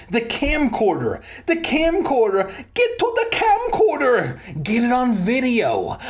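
high mock scream, climbing to a shriek; hammed-up screaming. A narrator's reading. Roxanne demanding the camcorder be grabbed, hammed-up scream. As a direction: escalate each demand, easing slightly at the end. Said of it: "Roxanne screamed at me".

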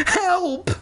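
very high, hammed-up shriek; crying for help. A narrator's reading. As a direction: squeal for help in mock panic. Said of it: "I cried".

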